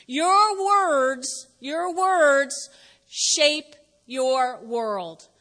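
very slow, weighty delivery; slow emphatic. Shown as a demonstration. Pastor dictating the big idea, very slow and weighty. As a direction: hammer each word for note-taking. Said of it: "Write it down".

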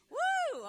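loud, high whoop of praise; joyful whoop. Real, not acted. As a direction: let out a big celebratory whoop. Preacher whoops after congregation response.

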